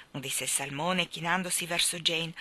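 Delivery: plain reading voice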